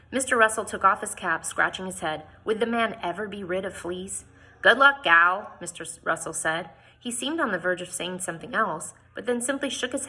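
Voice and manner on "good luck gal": louder, rough backwoodsman goodbye; gruff farewell. Acted. Mister Russell bids Jane goodbye, loud gruff farewell. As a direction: bark it out like an old trapper.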